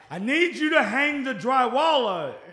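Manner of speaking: slow, high mock shout. Granddaddy to his non-English-speaking workers, slow loud shouting. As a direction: shout each word slowly as if that aids understanding. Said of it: "he will raise his voice".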